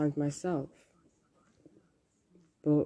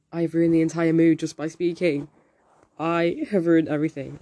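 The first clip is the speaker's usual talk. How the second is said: loud, mock-despairing outburst; dramatic self-reproach; 8 dB louder than usual. Imagining having killed the chat mood by speaking, dramatic self-reproach. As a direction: overplay the panic, loudly. Acted anxious thoughts in group chat.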